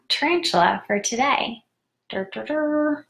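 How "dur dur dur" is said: sung little fanfare. Pretend. Host reveals today's tarantula card, little vocal fanfare. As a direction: hum out a playful high ta-da.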